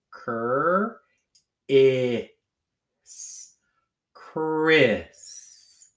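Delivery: Cur-is in stretched separate sounds, then a long loud Chris; slow sounding out. Shown as a demonstration. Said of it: "say it slowly. Sound out as you need to".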